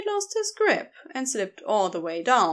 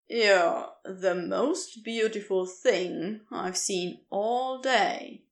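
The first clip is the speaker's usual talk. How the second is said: tender; 3 semitones lower, slower than usual. Acted. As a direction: let it slip out, dazzled and gentle. Crowley smitten with his rescuer.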